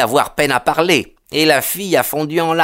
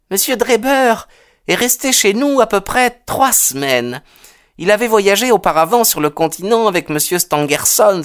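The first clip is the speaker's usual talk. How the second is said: higher-pitched, pained woman's voice; indignant mother; 8 semitones higher than usual. A narrator's reading. Landlady telling the detective of her lodger, indignant and high. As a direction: recount the lodger's conduct with distaste, pitched up. Mother recounts Drebber's conduct.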